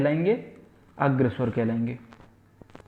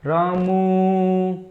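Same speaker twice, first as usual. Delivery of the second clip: Ramu called out long and slow, a bit higher; calling out; 3 semitones higher, 4 dB louder, much slower than usual. Shown as a demonstration. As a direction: call Ramu from far away, stretching the vowel. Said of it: "इस तरीके से".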